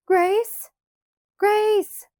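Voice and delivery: high, loud scream of a name. A narrator's reading. Laura sees only empty prairie, screaming for Grace. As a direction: scream for a missing child. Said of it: "she screamed".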